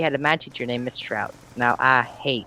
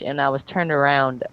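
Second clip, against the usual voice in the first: ordinary talk, nothing marked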